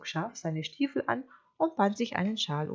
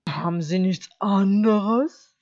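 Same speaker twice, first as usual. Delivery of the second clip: lightly voiced wolf, slow and louder; polite, hungry wolf; 2 semitones higher, 9 dB louder, slower than usual. Wolf refusing hay from the sheep, slow polite wolf. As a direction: ask slowly, with insinuating courtesy. Wolf asks for other food.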